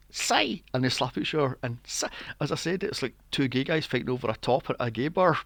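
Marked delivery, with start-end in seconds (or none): 0.1-0.6 high-pitched cartoon creature cry; imitated high Psyduck call. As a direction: squawk it like a silly duck monster. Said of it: "with the Psyducks going".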